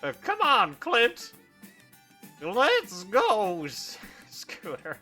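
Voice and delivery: put-on high, eager old miner; lightly voiced old prospector. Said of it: "turns into an old prospector".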